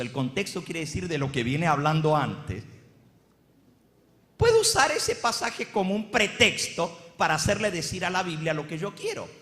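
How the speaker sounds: ordinary talk, nothing marked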